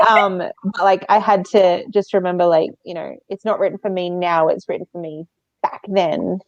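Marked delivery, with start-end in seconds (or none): none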